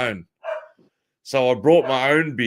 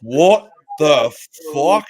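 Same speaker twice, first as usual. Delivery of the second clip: retold outburst; 8 dB louder, much slower than usual. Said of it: "And I went".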